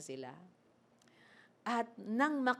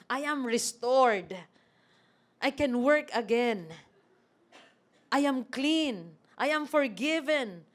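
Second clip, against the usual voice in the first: loud, rising rejoicing of a man made clean; joyful proclamation; 4 semitones higher, 6 dB louder than usual. Acted. Healed leper rejoicing before Jesus, loud and excited. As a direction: proclaim that you are restored.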